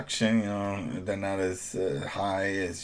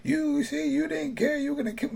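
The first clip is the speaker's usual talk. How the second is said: mock screaming Devin. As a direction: mimic a scream in a squeaky falsetto. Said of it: "this is Devin screaming at the town".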